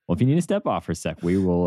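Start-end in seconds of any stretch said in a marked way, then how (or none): none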